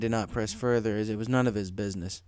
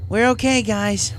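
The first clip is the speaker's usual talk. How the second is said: much higher, louder call; shouted; 11 semitones higher, 8 dB louder, slower than usual. Acted. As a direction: Rainbow calls back through the barrier to friends. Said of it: "Rainbow shouted back through the barrier".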